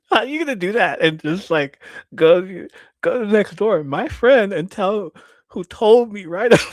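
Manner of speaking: high, loud exasperated mom with laughter; mock scolding. Mom scolding kid for going next door, mock exasperated. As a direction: scold loudly, imitating mom and cracking up.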